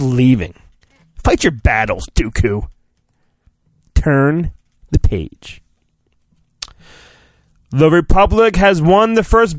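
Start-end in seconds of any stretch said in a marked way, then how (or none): none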